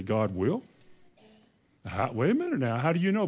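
quiet, doubtful "God will?" with a rising tune; doubting question. Retold or imitated. Preacher voicing a doubter's fearful question, softly doubtful. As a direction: question the promise in a soft, unsure voice. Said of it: "and I say".

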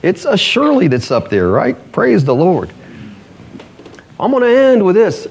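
high, ringing sermon voice; fiery preaching. Acted. Pastor preaching to the church about healing, fiery. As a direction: preach it to the congregation with full fire.

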